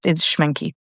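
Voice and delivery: normal talking voice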